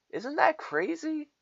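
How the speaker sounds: high, mocking squeal of disbelief; mock shocked squeal; sarcastic mock outrage. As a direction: sneer it like it's outrageous.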